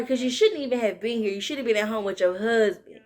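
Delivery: ordinary delivery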